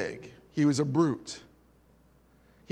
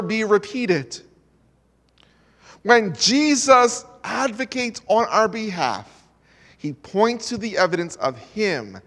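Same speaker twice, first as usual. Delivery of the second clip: rising sermon voice, louder on the key lines; preaching; 4 semitones higher, 4 dB louder than usual. Acted. Preacher tells listeners Christ took their punishment, earnest preaching. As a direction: press the message home to the congregation.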